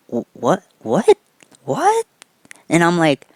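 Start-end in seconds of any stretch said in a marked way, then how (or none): 0.1-2.1 baffled repeated what, last one leaping high; stammering disbelief climbing to a squeak; confused what. Retold or imitated. Bully caught off guard by the invite, baffled.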